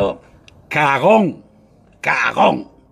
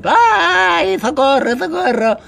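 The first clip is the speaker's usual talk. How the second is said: high, whiny mock plea; mock cry for help; 9 semitones higher, slower than usual. Mocking a rival who files copyright claims, high whiny mock plea. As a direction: whimper it like a spoiled child crying for help. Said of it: "como los niños chicos".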